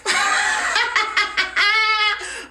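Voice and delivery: big high cackling laugh; loud laugh. Real, not acted. Teller laughing at her own cliffhanger, loud high laugh.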